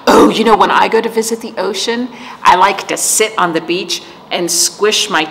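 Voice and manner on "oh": surprised gasp. Real, not acted. Sudden idea, gasp heard.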